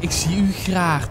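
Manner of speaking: slow, tender Flemish Ik zie u graag; slow Flemish love line with a rolled g; Flemish accent. Retold or imitated. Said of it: "Dat is toch altijd, "Ik zie u graag".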